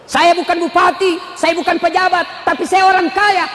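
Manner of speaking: high, declaimed preacher's voice; full-force preaching. Acted. Preacher speaking as a rich man without office, full-force preaching. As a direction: proclaim each clause to the crowd.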